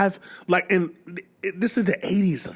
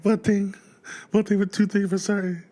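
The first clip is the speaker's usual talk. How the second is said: imitating Michael; 4 semitones higher than usual. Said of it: "like, why he".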